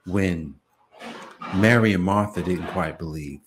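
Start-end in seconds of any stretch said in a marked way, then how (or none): none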